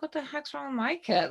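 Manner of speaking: a mock worried mother; imitating mom. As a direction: play the puzzled mother, lifted high. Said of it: "she's probably like".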